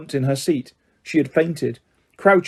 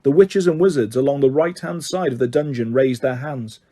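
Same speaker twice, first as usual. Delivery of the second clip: usual speaking voice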